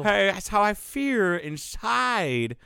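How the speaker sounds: high, slow drawn-out caricature; Dave Chappelle bit. Retold or imitated. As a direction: stretch it out in a high, put-on comedy voice. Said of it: "I was watching Dave Chappelle".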